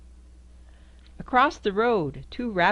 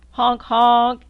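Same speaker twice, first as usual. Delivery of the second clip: loud, blaring honks; loud horn honk; 4 semitones higher, 11 dB louder than usual. Acted. Reading to a child, honking the jeep horn loudly.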